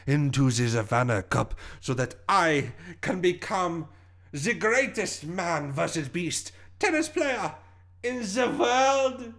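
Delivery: grandiose German-sounding villain climax, slow and rising high; villain's boast in a German-sounding accent. Acted. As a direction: slip into heavy German, drawing out the last words. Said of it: "You sounded very German there".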